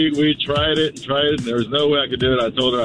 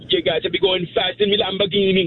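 high-pitched put-on Jamaican; Jamaican accent; 7 semitones higher than usual. Shown as a demonstration. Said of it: "No, a Jamaican accent".

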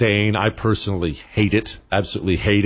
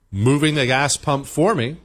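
usual speaking voice

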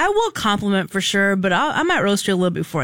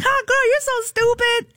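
much higher, drawn-out playful jab; laughing tease; 13 semitones higher, slower than usual. Retold or imitated. Woman re-enacting laughing at her friend's joke, high playful tease. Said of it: "as I'm laughing".

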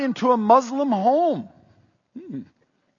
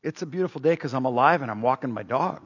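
put-on lower, softer, calm old neighbor's reply; blind neighbor Dave; 8 semitones lower, 5 dB quieter, faster than usual. Dave answers the pastor at his car window, calm and low. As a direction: answer gently, content with life. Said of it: "He's saying".

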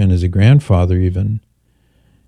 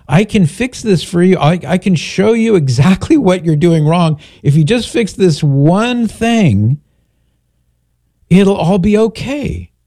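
pleading parent; 6 semitones higher than usual. Retold or imitated. Parent pleading with stubborn child.

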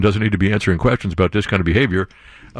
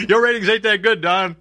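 mock taunt; 8 semitones higher than usual. Taunting Don about ratings.

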